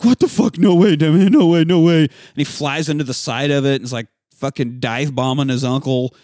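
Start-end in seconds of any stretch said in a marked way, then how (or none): 0.0-2.0 loud frightened cry of disbelief; freaking out as the creature attacks; panicked yelling. Retold or imitated. Uncle fleeing the flying boy, loud panicked cries. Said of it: "He's like".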